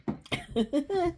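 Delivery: high-pitched breathy giggle; a squeaky laugh at the joke. Real, not acted. Reader cracking up at the silly abbreviation, high giggle.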